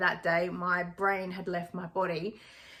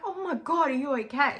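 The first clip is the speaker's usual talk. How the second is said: high, urgent worry for her son; a mother's sudden fright after a crash; panicked; 6 semitones higher, 3 dB louder than usual. Retold or imitated.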